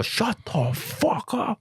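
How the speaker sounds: high, drawn-out mock yell; held-back pretend scream; imagined yell. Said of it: "I wanted to yell".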